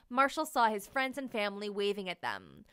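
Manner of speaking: ordinary delivery, nothing marked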